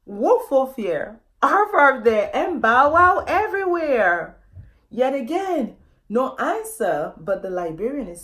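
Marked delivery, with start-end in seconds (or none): 0.1-4.4 loud, higher, sing-song woofs and arfs; mock dog barking. A narrator's reading. Elephant family calling for lost puppy, playful loud barking. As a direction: bark it out like a big family calling a lost pup.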